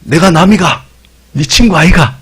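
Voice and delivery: Jesus as a Gyeongsang buddy; Gyeongsang dialect. Retold or imitated. Jesus voiced in Gyeongsang dialect to disciples. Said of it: "경상도 오늘 버전으로".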